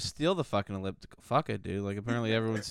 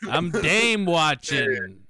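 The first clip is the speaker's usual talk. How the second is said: put-on slow, higher old-timey voice; bubbly 1920s flapper slang; 4 semitones higher, 4 dB louder, much slower than usual. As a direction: stretch it out like a 1920s dandy. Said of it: "a nineteen twenties guy".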